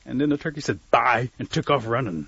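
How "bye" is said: abrupt loud goodbye; turkey's parting shout; shouted getaway farewell. Acted. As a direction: toss out a loud, cheeky bye.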